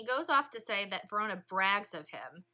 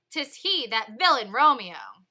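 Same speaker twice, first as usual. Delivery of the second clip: angry Tybalt; 10 dB louder than usual. Acted. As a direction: spit out the name with scorn.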